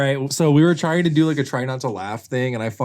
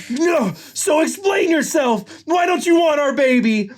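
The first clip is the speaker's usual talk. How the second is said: high, melodramatic shouting; anguished demand; slower than usual. Acted. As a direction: demand answers in a very high, heated voice. Nick demanding explanation in quarrel.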